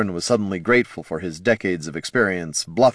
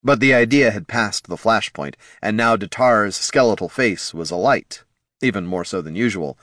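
ordinary delivery

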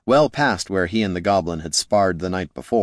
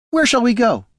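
much higher, timid question; nervous wizard; 14 semitones higher, 4 dB louder than usual. Acted. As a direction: give up meekly. Wizard surrenders.

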